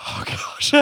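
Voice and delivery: whispered, airy gasp of words; mock relief. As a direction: let it out voicelessly, as after a fright. Relief after being scared.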